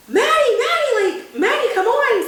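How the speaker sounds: high, urgent call to a friend. Retold or imitated. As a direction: call her name across the theater. Kids calling to Maddie on screen.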